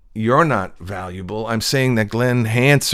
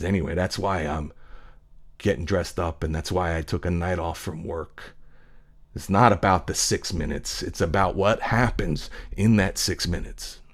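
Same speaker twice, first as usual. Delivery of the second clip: hushed retelling; 4 semitones lower, 7 dB quieter than usual. Retold or imitated. Cook ends his monologue.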